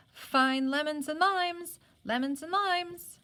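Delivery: loud, slow, sing-song peddler calling out limes; street vendor's cry. A narrator's reading. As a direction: hawk the limes to the whole street. Street peddler crying his wares.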